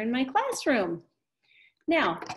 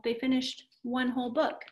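ordinary talk, nothing marked

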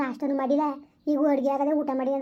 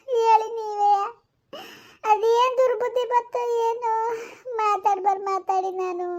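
put-on weeping old mother; 7 semitones higher than usual. Mother weeps over son.

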